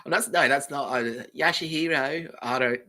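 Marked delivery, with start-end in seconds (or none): 1.4-2.3 put-on higher, drawn-out camp bogan accent; camp Aussie bogan.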